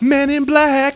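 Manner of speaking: high, loud hero one-liner; mock Will Smith line; Will Smith impression. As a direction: deliver it like an action star. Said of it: "Will Smith would be like".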